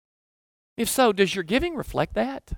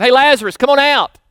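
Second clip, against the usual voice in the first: loud call, pitched high, to a man in a tomb; 7 semitones higher, 8 dB louder than usual. Retold or imitated. Jesus calling Lazarus out of the tomb, loud high call. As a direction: call him out of the grave, loudly. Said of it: "and he called out".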